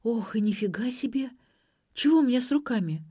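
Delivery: breath-led exclamation, then high quick question; startled gasp. A narrator's reading. Ksenia seeing her bandaged hands, startled. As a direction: gasp it, then hurry up in pitch. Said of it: "ойкнула".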